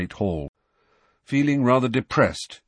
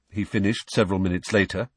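ordinary delivery

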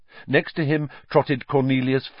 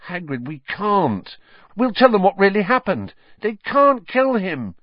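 desperate pleading; 11 semitones higher, 5 dB louder than usual. Acted. Children begging to save Buckbeak.

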